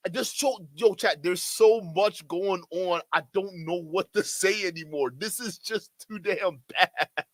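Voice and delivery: ordinary delivery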